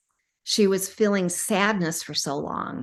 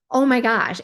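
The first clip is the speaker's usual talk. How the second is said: louder, higher burst of joy; delighted gasp; 5 semitones higher, 6 dB louder than usual. Retold or imitated. Client walking without back pain, joyful louder exclaim. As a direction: exclaim it as the pain-free surprise hits. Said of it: "she's like".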